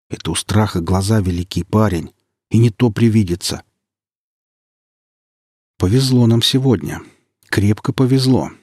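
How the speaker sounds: usual speaking voice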